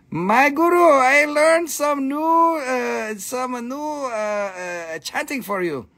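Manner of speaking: a hint of an eager pupil reporting; telling the guru. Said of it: "You need to tell your, your guru".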